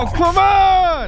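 slow, high, loud yell; exasperated shout. Real, not acted. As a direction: shout in frightened frustration. Another scare.

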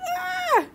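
very high short squeal of delight; excited squeal. Real, not acted. Squealing with joy at the neon parrot offer.

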